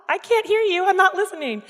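put-on high, loud childish taunt; kid refusing to listen. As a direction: block out your parents like a stubborn kid. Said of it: "like when you were a kid".

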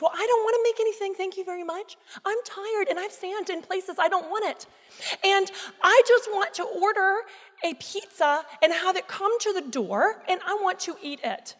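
high-pitched indignant comeback; indignant retort. Retold or imitated. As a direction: argue back, stubborn and fed up. Acts out her pizza argument.

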